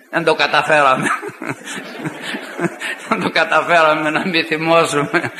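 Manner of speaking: chuckling through the retold words; laughing admission. Opponents admitting to the preacher they failed to stay calm, laughing. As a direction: break into laughter while quoting them.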